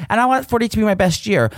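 ordinary delivery

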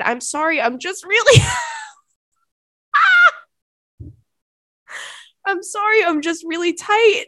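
high, loud quote squeezed through laughter; breaking up laughing. Real, not acted. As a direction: struggle to get the line out without laughing. Reads quote while breaking up laughing.

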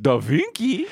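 high-pitched puzzled catchphrase; put-on baffled high voice; Da Vinci twins. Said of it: "make those the Da Vinci twins".

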